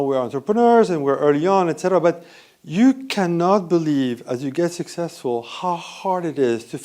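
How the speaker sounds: ordinary talk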